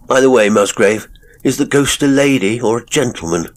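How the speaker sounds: loud, high flippant question; teasing the believer with a flippant question. A narrator's reading. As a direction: toss the question off lightly. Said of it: "he asked flippantly".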